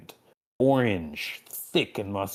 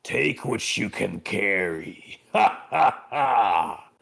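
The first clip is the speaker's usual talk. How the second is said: evil demon cackle; wicked laugh; 4 dB louder, slower than usual. Acted. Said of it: "He gives you a wicked smile".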